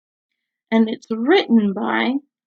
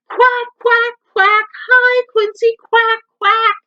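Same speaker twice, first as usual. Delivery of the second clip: high, loud quacking shout; loud duck shout; 11 semitones higher, 6 dB louder than usual. A narrator's reading. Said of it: "shouts Dottie".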